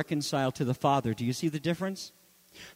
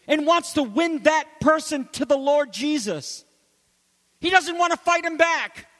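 fervent preaching; 8 semitones higher than usual. Real, not acted. Pastor urging the congregation, preaching fervently. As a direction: build the sermon with full force.

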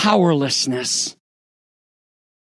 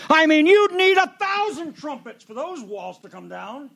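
sarcastic aside; 9 semitones higher, 4 dB quieter than usual. Acted. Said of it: "You're too sarcastic".

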